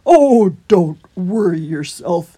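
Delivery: loud, slow, drawn-out character voice; reassuring hedgehog. A narrator's reading. Holgate reassuring frightened Christopher, big slow voice. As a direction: soothe him in a big, slow voice.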